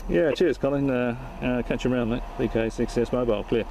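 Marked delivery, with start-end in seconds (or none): none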